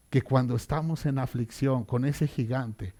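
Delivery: ordinary talk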